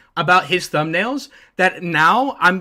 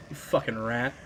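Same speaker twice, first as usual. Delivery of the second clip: muttered insult; 5 semitones lower, 10 dB quieter than usual. Real, not acted.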